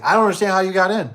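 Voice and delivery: high, loud disbelieving voice; baffled lady. Retold or imitated. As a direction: play the homeowner who can't figure it out.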